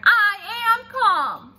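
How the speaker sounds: high, loud, slow indignant holler; loud ironic holler. Acted. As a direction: bellow that you're calm. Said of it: "I hollered back".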